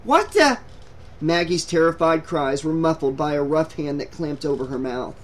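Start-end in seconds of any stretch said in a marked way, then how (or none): 0.0-0.6 high, loud yelp of fright; startled scream. A narrator's reading. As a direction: cry out as if suddenly grabbed. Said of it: "Maggie screamed".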